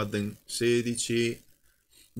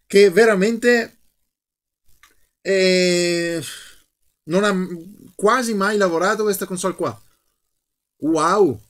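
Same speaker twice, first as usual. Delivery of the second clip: amazed; 6 semitones higher, 5 dB louder than usual. Real, not acted. Amazed at ancient firmware.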